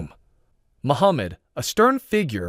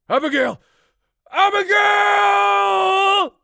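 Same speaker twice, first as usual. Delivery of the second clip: calling out; 4 dB louder, much slower than usual. A narrator's reading. Calling out through the chaos.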